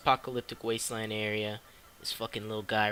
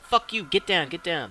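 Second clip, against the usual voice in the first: imitating a higher, louder urgent order; retold urgent warning; 5 semitones higher, 6 dB louder than usual. Mysterious voice warns the boy in the pit, urgent retold warning. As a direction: act out the urgent command to get down.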